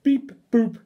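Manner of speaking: high, loud beep boop; hammed-up robot noises. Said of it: "make silly sounds like".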